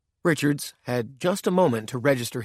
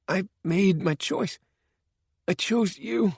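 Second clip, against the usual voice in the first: much higher, slow, pitiful voice; pathetic plea; 9 semitones higher, slower than usual. Acted. Dying man pleads with his killer, pathetic and tearful. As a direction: plead as if about to cry. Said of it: "Richards's voice sounded pathetic".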